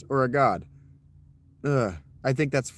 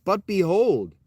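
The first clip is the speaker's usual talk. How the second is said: behold said louder and higher; corrected behold; 6 semitones higher, 5 dB louder, slower than usual. Shown as a demonstration. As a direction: fix the slip and land on behold. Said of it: "excuse me".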